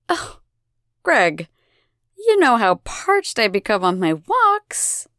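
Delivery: drawn-out, entitled purr; lightly voiced lazy diva; languid goddess. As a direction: sweetly demand a drink. Elena character voice.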